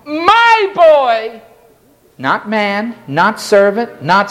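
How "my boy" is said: pleading father, pitched up; emphatic anguished father; centurion's plea. Acted. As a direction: cry out for your boy. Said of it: "The Greek is emphatic".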